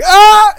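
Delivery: high loud mock scream; dad's loud startled shriek. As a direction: yell out in sudden fright. Dad startled in bath.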